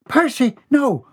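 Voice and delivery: very high pleading mother; very high begging cry. A narrator's reading. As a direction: plead with the boy to stop. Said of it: "Bobby Sue begged".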